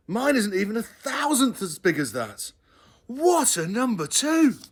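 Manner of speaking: put-on wide-eyed little boy in wonder; gushing child voice; excited child. A narrator's reading. Child gushing about elephant.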